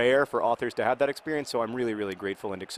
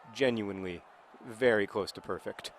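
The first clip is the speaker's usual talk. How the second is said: ordinary talk, nothing marked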